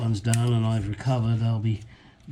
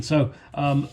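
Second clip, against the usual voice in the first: normal talking voice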